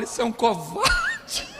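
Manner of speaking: mock sobbing, words drawn out slowly; hammed-up tearful name-calling; imitated wife's insult. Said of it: "E ela fala".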